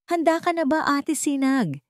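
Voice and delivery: a hint of a hopeful child asking his big sister; boy asking Ate Sinag if she is ready, lightly childlike; young boy Maliksi. A narrator's reading. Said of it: "tanong ni Maliksi".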